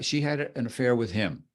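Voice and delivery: level tune with a period after HIM; statement of fact. Shown as a demonstration. Teacher demonstrating inflection, matter-of-fact fall on HIM. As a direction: end "him" as a simple full stop. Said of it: "Statement of fact".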